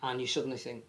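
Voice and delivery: ordinary delivery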